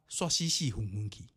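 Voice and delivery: plain reading voice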